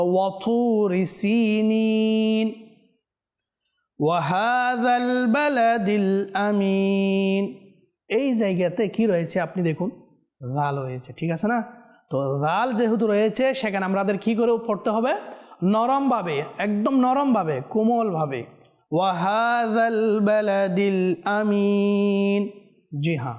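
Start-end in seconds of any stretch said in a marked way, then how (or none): 0.0-7.7 slow, drawn-out melodic tajweed chant; Quran recitation. Shown as a demonstration. Teacher reciting a verse for viewers to follow, slow melodic chant. 18.9-22.5 slow, drawn-out melodic tajweed chant; Quran recitation. Shown as a demonstration. Teacher reciting a verse for viewers to follow, slow melodic chant.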